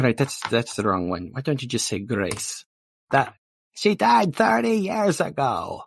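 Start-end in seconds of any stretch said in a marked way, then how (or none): 3.1-5.8 high, slow mock wail; stretched-out comic yell; hammed-up outcry. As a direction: cry out indignantly, pitched way up. Mishears "grace" as a dead person.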